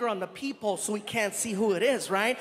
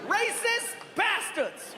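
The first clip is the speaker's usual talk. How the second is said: high, drawn-out yell of accusation; hammed-up high holler; outraged cry through door; 9 semitones higher, slower than usual. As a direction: wail it slowly, appalled. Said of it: "Outside the door, we heard".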